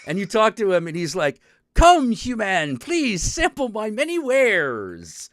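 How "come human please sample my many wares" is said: higher, slow, grand little cat merchant; cat shopkeeper voice. Retold or imitated. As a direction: play the cat spirit inviting a human to buy. Cat spirit shopkeeper speaks.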